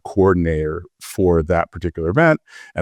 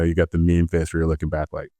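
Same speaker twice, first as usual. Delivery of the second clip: ordinary talk, nothing marked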